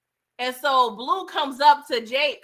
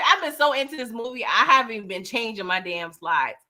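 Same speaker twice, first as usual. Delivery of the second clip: usual speaking voice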